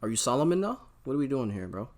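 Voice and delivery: ordinary talk, nothing marked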